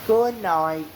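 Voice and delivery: ordinary delivery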